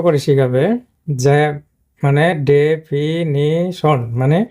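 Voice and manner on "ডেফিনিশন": ডেফিনিশন broken slowly into de-fi-ni-shon; very slow teaching breakdown of ডেফিনিশন, de... fi... ni... shon; syllable by syllable. Shown as a demonstration. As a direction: split ডেফিনিশন into separate, drawn-out syllables. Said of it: "এখানে একটা আলাদা করবে syllable".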